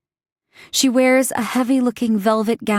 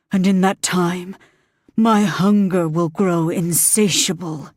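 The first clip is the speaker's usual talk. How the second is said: demon voice; 4 semitones lower than usual. Acted. Demon bargaining with Green, low and menacing. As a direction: speak as an ancient demon granting a bargain.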